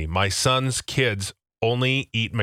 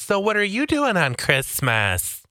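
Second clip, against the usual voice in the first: nosy coworker; 8 semitones higher than usual. Retold or imitated. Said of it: "my coworkers ask".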